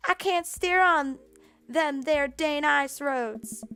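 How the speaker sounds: deliberately bad backwoods accent, drawn out slowly; a truck driver's drawl done badly on purpose; horrible rural accent. Dad voicing a TV trucker, hammy bad backwoods accent. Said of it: "I gotta do a horrible attempt at an accent".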